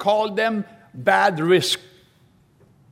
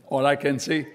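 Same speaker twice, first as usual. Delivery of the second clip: usual speaking voice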